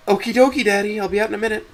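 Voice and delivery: very high-pitched, sing-out daughter voice; chipper daughter. Acted. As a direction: chirp it back to Dad. Voices daughter answering Dad cheerfully.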